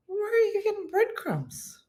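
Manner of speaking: high, disbelieving question; incredulous. Retold or imitated. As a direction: ask it in pure disbelief. Incredulous question in retold video.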